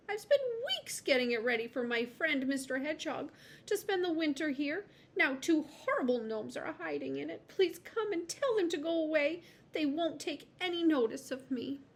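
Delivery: begging Hazel to chase the gnomes; miserable lizard. A narrator's reading. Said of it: "the lizard said miserably".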